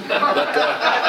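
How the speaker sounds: laughing. Real, not acted. Laughing at own joke.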